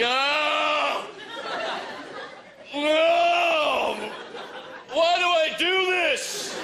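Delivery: high, slow whining complaint; overstuffed dad groaning. Retold or imitated. Father complaining after overeating at the holidays, high slow whine. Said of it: "he's always complaining about how much he ate".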